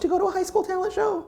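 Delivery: high, exasperated complaint; exasperated high whine. Real, not acted. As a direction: whine it out in mock despair. Complaining about young contestants.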